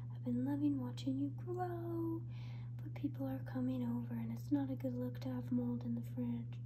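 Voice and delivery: soft unvoiced whisper to the mold; whispered sweet talk. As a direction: hush it tenderly like a secret.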